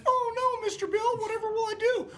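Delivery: very high, far-off mocking falsetto; mocking a helpless victim in a shrill falsetto; mock helpless whine. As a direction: squeak it out like a cartoon victim.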